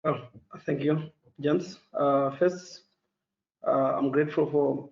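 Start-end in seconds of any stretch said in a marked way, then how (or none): none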